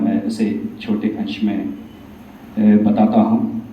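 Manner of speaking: normal talking voice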